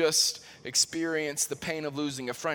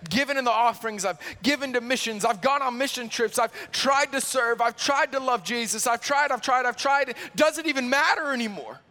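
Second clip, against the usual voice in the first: building anguished plea; 6 semitones higher, 4 dB louder than usual. Retold or imitated. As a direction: pitch climbing higher line by line, tried repeated harder. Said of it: "asking that question".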